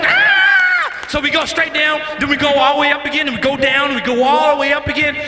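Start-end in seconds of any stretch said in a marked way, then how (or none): 0.0-0.5 high, loud squeal; imitated wife's scream. Wife answering husband's panic on the drop, screaming. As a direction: mimic her carefree coaster scream. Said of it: "And she's like".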